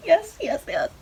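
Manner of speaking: high, breathless yes yes yes; overjoyed fan squealing yes; thrilled. Retold or imitated. Fan thrilled as idol dances for her, high squeal.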